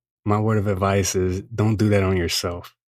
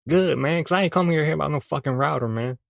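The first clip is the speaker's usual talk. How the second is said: heated second voice; 5 semitones higher, faster than usual. Acted. Second voice arguing with himself.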